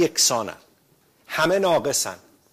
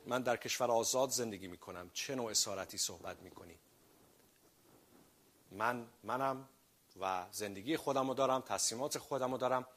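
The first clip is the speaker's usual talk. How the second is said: much softer, offhand voice of a self-assured man; smug passerby; 2 semitones lower, 15 dB quieter than usual. Retold or imitated. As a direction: brush it off quietly, sure of yourself. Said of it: "میگه".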